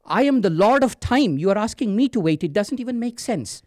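high, loud, incredulous outburst; indignant god of death. A narrator's reading. Lord of Time asked to wait, loud indignant protest. Yama stunned at being told to wait.

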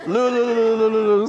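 high, loud hunting call; loud, high view-halloo. Acted. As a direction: halloo like a huntsman after his quarry.

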